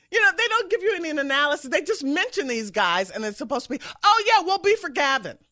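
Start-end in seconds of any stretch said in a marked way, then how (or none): 4.0-5.4 louder, higher naive cheer; mock gullible voter. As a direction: play the easily swayed voter, loud.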